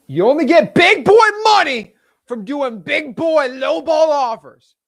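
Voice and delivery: high, loud, slow catchphrase delivery; preaching lowballing to viewers; hype preaching. Real, not acted. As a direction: drill the motto home.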